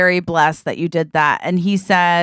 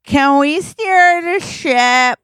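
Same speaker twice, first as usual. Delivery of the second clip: put-on drunken pleading; 7 semitones higher than usual. Drunk guests begging the captain to steer the ship, pleading.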